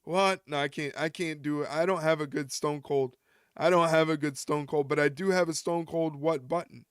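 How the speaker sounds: usual speaking voice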